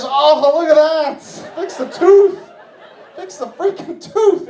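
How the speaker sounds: high-pitched mock outburst over the tooth; exasperated plea. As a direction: plead in a high, comic whine of exasperation. Acted outburst at partner's bad tooth.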